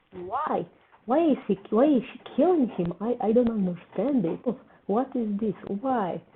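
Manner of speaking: high, loud shocked protest at the screen; shocked outcry. Real, not acted. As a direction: cry out in real disbelief. Shock as a favourite character is killed.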